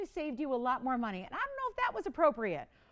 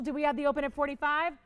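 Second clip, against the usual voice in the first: ordinary talk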